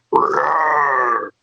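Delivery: imitated lion's roar, plain growl; rolling mock lion roar; African lion roar. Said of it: "a lion from Africa would be like".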